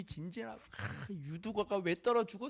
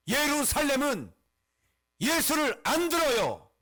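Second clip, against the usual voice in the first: loud, high declamation with falling endings; emphatic pulpit cry; forceful proclamation; 5 semitones higher, 6 dB louder than usual. Acted. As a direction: proclaim "예루살렘은" and "안 들어요" at full force, pausing between phrases. Same statement proclaimed at full force.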